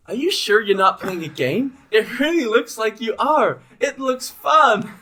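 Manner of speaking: put-on high, loud, slowing little girl; a wide-eyed excited daughter.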